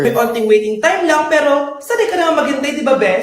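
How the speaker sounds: loud, upbeat sponsor pitch; punchy promo read. Acted. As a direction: sell the ride app cheerfully. Reads the sponsored Grab ad.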